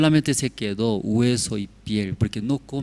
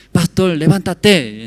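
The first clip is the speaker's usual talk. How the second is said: a firm wake-up call; llamado fuerte; 5 semitones higher, 8 dB louder, slower than usual. Retold or imitated. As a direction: call out firmly to wake someone. Despertado en el bus. (llamada fuerte para despertar)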